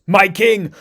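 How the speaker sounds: loud, urgent call; frantic yell. Acted. Okoye yelling into her beads for the king. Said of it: "yells into her Kimoyo beads".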